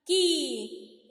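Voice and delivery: की stretched out loud; loud long ी. Shown as a demonstration. Teacher showing the long vowel to children, loud. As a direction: lean on the long ई in की. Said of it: "बाद में बड़ा की".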